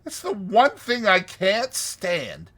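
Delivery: high, loud, insistent pleading; imploring. A narrator's reading. Toad urging Rat to leave the river, imploring. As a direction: press him hard, pitched well up. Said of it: "said Toad imploringly".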